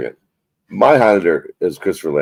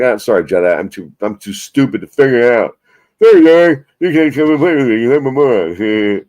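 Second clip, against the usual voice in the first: higher, pushier whining taunt that slows at the end; mock whiny kid; 4 semitones higher, 4 dB louder than usual. Host imitating himself failing to send the link, mock whiny kid. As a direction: play a bratty kid sulking and blaming. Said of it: "I sort of been like".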